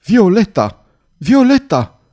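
high, loud calling of a name; desperate roared call; howling cry. A narrator's reading. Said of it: "urlă el un răcnet îngrozitor".

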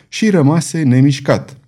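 ordinary delivery, nothing marked

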